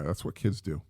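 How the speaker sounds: usual speaking voice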